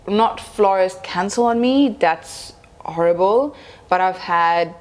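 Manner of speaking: usual speaking voice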